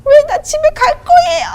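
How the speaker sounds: shrill, very high scream at full volume. Retold or imitated. Wife screaming at God in the prayer room, very high and loud. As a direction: wail it out like a tantrum. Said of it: "하면서 소리 질렀어요".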